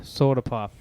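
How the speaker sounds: ordinary talk, nothing marked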